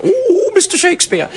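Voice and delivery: very high, whooping stage voice; comic nurse. Acted. Juliet's nurse doing comedy speeches, high and hammy. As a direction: ham it up like a comedy turn. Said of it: "she does a lot of comedy speeches".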